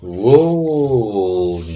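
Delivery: surprised loud whoa; startled exclamation at the video. Real, not acted. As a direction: react big to the next clip. Reacting to next clip.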